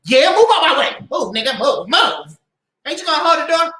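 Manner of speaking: loud, impatient orders; bossy mock yelling. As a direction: imitate a domineering partner shoving past. Acts bossy partner ordering man about.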